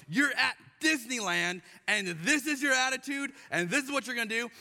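put-on fed-up dad scolding his kids at Disneyland; frustrated parent. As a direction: scold the grumpy kids, loud and high. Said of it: "I was so frustrated 'cause I'm going".

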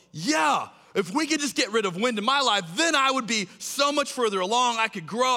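high, eager rush of enthusiasm. Acted. Listener imagining life without any wind, eager. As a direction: gush it as an excited hypothetical.

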